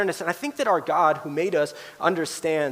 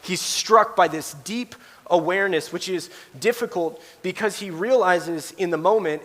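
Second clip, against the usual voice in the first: ordinary delivery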